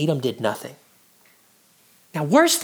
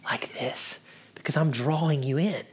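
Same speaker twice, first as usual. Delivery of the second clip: hushed whisper; 4 semitones lower, 9 dB quieter than usual. Shown as a demonstration. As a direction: drop to a hushed whisper to draw listeners in. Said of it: "I begin to talk like this".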